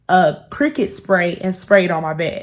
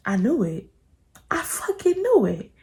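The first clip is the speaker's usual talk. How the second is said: seethe it under your breath; quiet fury; 2 semitones higher, 7 dB quieter than usual. Retold or imitated. Reading his messages, softer seething. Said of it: "I'm just just like".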